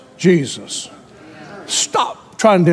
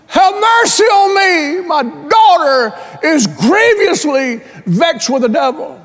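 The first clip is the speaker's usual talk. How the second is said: begging shout; 9 semitones higher, slower than usual. Retold or imitated. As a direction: cry out for your child's life. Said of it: "and she cried".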